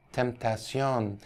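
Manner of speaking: temptation as tem-tah-see-on, slow; Middle English temptation. Shown as a demonstration. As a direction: sound out temptation the old French way. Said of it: "if that's the right pronunciation".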